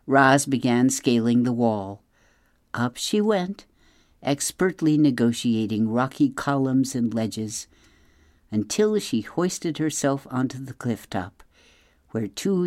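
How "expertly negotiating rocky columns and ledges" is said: ordinary delivery, nothing marked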